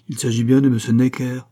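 scornful, self-important retort. A narrator's reading. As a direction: brush it off loudly, full of himself. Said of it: "dit Pitou avec suffisance". (avec suffisance)

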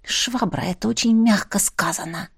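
Швабра whispered softly and grimly. Acted. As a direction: drop to a grim whisper. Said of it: "мрачно заметила я".

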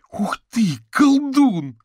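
put-on excited boy, very high and loud; amazed exclamation. A narrator's reading. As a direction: cry out in wonder like a kid. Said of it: "воскликнул пацан". (восторженный мальчишеский вопль)

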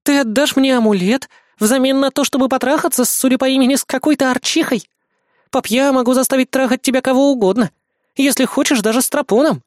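put-on high, cheeky young girl; bratty devil girl. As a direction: tease him like a spoiled brat. Lana mocking her "dad.

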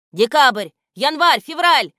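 stern shout. A narrator's reading. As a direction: bark the names out sternly. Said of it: "строгим голосом кричать".

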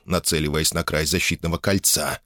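ordinary delivery

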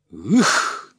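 loud, explosive grunt of a swing; effort grunt. Acted. Егор strikes his son, sharp effort grunt. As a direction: grunt as if landing a blow.

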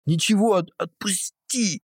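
squeaky high stammering plea; high pained plea. Acted. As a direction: stammer it out in pain. Captive in pain.

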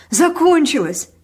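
loud, high exclamation, drawn out; insistent repeat. A narrator's reading. Man insisting it is over, loud and insistent. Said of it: "твердил он".

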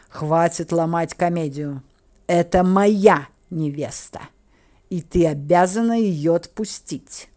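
slow, forceful demand; stern, insistent order; angry demand. A narrator's reading. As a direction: press each word, loud and firm. Count angrily demands his bride back.

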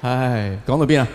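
normal talking voice

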